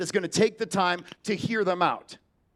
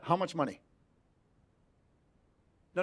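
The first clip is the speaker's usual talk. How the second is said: ordinary delivery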